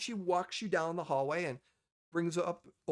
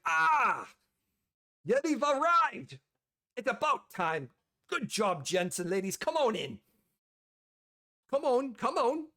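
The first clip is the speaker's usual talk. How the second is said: higher, louder hearty dwarf boss; hearty welcome; 6 semitones higher, 5 dB louder than usual. Acted. Dwarf boss waving the party into her office, hearty and loud.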